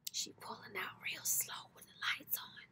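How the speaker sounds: soft unvoiced whisper. Real, not acted. Telling viewers about the neighbour's car, whispered. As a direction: hush it like you're watching secretly. Watching neighbour drive off, hushed.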